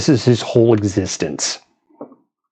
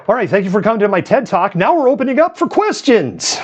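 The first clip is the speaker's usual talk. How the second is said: hammed-up presenter sign-off, higher and fast; mock TED speaker; 9 semitones higher than usual. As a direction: play the slick keynote host. Joking TED talk sign-off.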